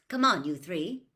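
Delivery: usual speaking voice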